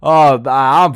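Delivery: very slow, drugged-out drawl; imitating slurred speech. Zoned-out friend admits he took the pills, imitated slurring. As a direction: drag the words out, mock-wasted and barely articulate. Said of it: "sounded like his mouth was falling apart".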